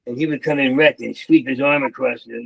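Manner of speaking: ordinary talk, nothing marked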